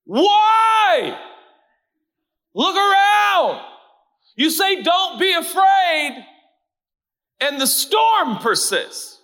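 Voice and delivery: high, pleading shout of protest; yelling back at God. Acted. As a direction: throw each complaint up at heaven. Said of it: "yelling back to God".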